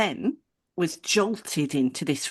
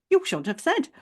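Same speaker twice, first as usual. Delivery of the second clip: high, pointed mimicry; mock reproachful receptionist; 8 semitones higher than usual. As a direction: imitate her repeated reproach in a high voice. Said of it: "her rhetorical pattern of".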